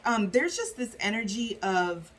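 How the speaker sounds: ordinary delivery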